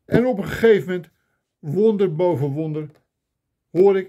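ordinary talk, nothing marked

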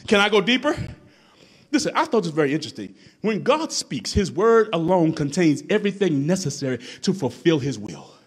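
ordinary talk, nothing marked

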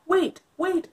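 eager princess. Acted. Princess calls to dragon.

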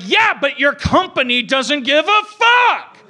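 mock retort. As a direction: fire back at the flight attendant. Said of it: "I'm like".

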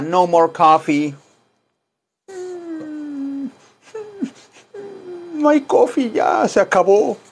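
hammed-up whimper over the coffee; mock sad. Teacher pretending to grieve the last of the coffee, mock sad. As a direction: mourn the empty cup, playfully.

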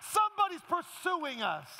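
high, loud, building preacher's cry; full-force preaching. Acted. Pastor preaching to the congregation about Jesus, full-force preaching.

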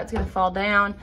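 ordinary talk, nothing marked

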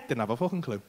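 ordinary delivery

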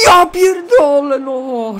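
loud, high, drawn-out swearing; anguished shout. Real, not acted. Gamer seeing the shot hit the bar, anguished shout. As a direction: wail it at the near miss. Header hits the crossbar.

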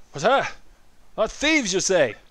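high, loud, confused elder; senile old man. Acted. Old Koopa double-checks with visitors, senile old-man voice. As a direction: query it like an old man who missed it. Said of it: "He's very senile".

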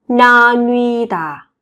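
slow, lengthened 나뉘다; slow model. Shown as a demonstration. Teacher to Korean learners, slow model. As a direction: hold the vowels of 나뉘다 long.

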